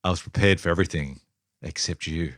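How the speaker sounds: low, sultry line read; sultry movie voice. Acted. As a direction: purr it like a film seductress. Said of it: "Whose, whose voice are you doing there".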